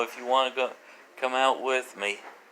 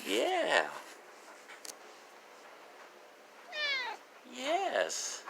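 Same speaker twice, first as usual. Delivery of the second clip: much higher, softer murmurs; soft pet coo; 10 dB quieter, much slower than usual. Real, not acted. Owner petting her dog, soft high coo. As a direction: hum approval gently up high.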